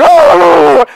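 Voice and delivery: high imitated dog yowl; startled dog howl. Comic imitating the poor woken dog, high drawn-out howl. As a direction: yelp out a long comic howl.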